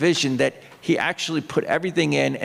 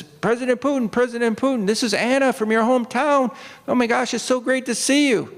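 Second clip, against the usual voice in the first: high, gushing call from the shore; excited fan calling; 10 semitones higher than usual. Retold or imitated. A fan on the shore calls excitedly to Putin, high and gushing. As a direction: squeal out to a celebrity, much higher.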